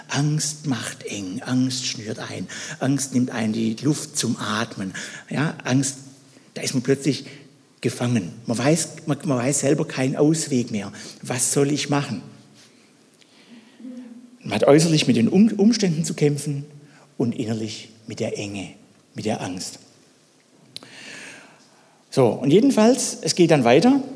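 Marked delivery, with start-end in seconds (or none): 0.1-1.4 Angst macht eng whispered slowly, breathy and hushed. Shown as a demonstration. Preacher whispers to the congregation how fear tightens. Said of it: "Merkt ihr was?". 1.4-4.9 hushed. Shown as a demonstration. Fear described hushed. 17.2-19.8 hushed. Shown as a demonstration. Fear described hushed.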